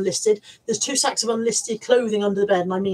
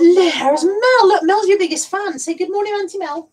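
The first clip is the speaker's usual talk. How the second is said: puppy talk; 9 semitones higher, 7 dB louder than usual. Real, not acted. Talking to the puppy about Mel.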